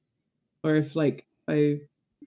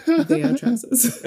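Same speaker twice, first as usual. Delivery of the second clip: "trousers" slow, high and laughing; mock insistence; 8 semitones higher, 3 dB louder, slower than usual. Mum teasing about American dad's wording, mock insistence.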